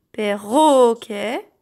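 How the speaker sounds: "perroquet" slowly, middle RO stretched and strong; stress on RO. Shown as a demonstration. Teacher comparing syllables for children, "perroquet" with RO stressed. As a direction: draw out the "ro" in "perroquet". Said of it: "C'est la même syllabe".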